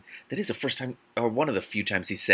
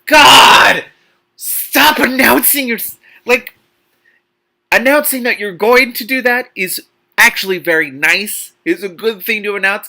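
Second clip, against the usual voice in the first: much higher, louder, fed-up yell; exasperated shout; 11 semitones higher, 12 dB louder, slower than usual. Real, not acted. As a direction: yell at the character in disbelief.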